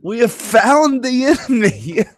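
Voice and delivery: high, slow pompous war cry; mock battle call. Raiders rallying against old men and women, high pompous cry. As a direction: call the troops like a pompous herald. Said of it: "and they're like".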